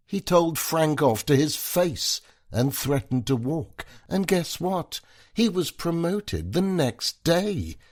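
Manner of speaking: high, gushing praise; excited admiration. Acted. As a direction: gush about him. Wife praises Jacob excitedly.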